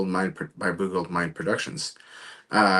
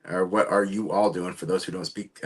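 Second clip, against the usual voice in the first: normal talking voice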